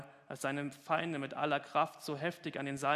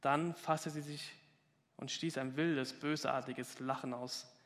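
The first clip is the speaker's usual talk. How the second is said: ordinary talk, nothing marked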